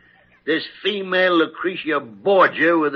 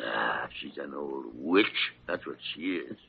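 softer, lower grumble; grumbling aside; 12 semitones lower, 12 dB quieter than usual. Acted. Gillespie grumbling to Kildare about Parker, softly. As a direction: mutter it under your breath.

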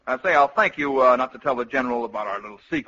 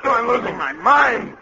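panic; 8 semitones higher, slower than usual. Acted. General panicking under attack.

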